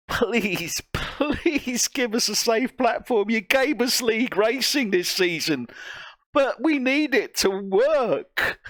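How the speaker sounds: high-pitched, imploring appeal; exasperated plea. Real, not acted. Commentator begging the game makers for a stable platform, exasperated plea. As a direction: beg with exaggerated emphasis on each plea.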